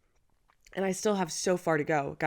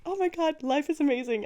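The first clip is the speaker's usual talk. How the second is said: higher, gushing mock rapture; giddy delight; 9 semitones higher, 4 dB louder than usual. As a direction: play your giddy ovulating self. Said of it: "I'm just like".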